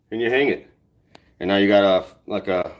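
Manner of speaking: ordinary talk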